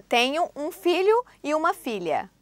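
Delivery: ordinary delivery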